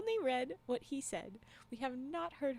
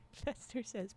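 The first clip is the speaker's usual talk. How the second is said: usual speaking voice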